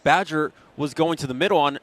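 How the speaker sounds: ordinary talk, nothing marked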